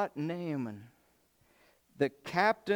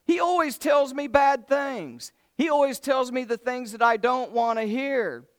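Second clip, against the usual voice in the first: higher, louder complaining king; mock sulky king, pitched up; whiny king; 7 semitones higher, 6 dB louder than usual. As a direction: whine like a sulking king. King complains about prophet.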